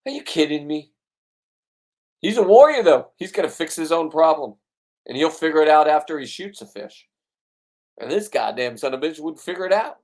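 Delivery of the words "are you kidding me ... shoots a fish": retold yell. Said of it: "I'm yelling at him".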